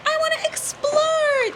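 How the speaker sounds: put-on high, drawn-out yearning girl voice; cartoon caveman girl. Caveman girl pleading with her scared dad, high mock yearning. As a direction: whine it slowly like a dreamy cartoon daughter. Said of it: "And she's like".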